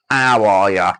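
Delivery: a rough English impression, belted out; Wes. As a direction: lightly mimic a mate's loud, stretched hello. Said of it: "I said that like Wes then".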